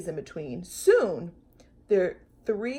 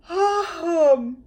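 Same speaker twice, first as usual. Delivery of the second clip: yawn; 8 semitones higher, 6 dB louder than usual. Acted. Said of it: "Corduroy yawned".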